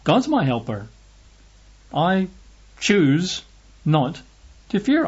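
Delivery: each word set apart, pitch leaping up on fear; bold, word by word. Shown as a demonstration. Preacher declaring he chooses not to fear, boldly spaced words. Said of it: "says that we can boldly say".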